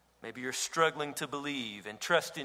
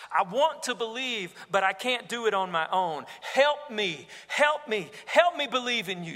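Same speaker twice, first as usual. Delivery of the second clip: higher, louder pleading prayer building to a cry; desperate pleading father; 6 semitones higher, 4 dB louder than usual. Acted. Troubled father crying out to Jesus, desperate and raised. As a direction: beg Jesus for help, rising in urgency. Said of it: "He's saying".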